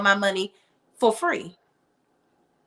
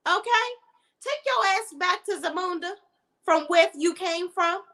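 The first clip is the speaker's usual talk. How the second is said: high-pitched, sassy brush-off of a pushy suitor; a woman telling the suitor off, high and sassy; sassy comeback; 10 semitones higher than usual. Retold or imitated. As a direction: pitch way up and tell him off with attitude. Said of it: "Girl, I would have been like".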